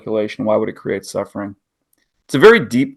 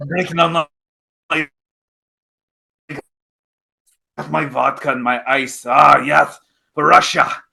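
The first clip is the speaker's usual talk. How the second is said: put-on Russian accent; 2 semitones higher than usual. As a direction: ham up a bad Russian accent. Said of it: "Terrible Russian accent".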